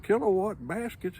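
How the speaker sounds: high, incredulous repeat of the words; the young engineer, baffled by the boss's joke, asks in disbelief; baffled question. Retold or imitated. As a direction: echo it back in disbelief. Boy baffled by kilowatt baskets.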